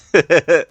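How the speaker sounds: high, loud burst of laughter. Real, not acted. Streamer laughing about the chair scene, high and loud. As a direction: crack up at the memory.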